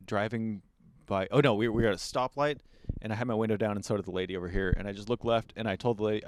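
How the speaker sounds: usual speaking voice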